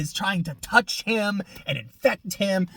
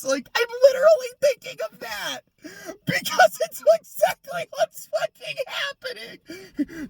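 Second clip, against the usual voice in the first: much higher, strained crying yell; exasperated shout. Real, not acted. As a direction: scream it out in disbelief. Ranting, near crying.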